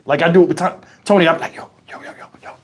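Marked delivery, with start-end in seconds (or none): 1.6-2.5 barely audible, whispered "yo"s; tiny rapid whisper to make someone look stupid; sly under-breath calling. Shown as a demonstration. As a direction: tease someone almost without voice. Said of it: "I get that shit from Bunny".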